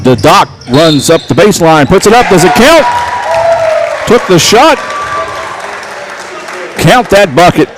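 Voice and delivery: play-by-play burst of excitement; excited call. Real, not acted. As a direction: yell for the bucket to count. And-one layup call.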